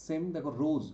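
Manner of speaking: ordinary talk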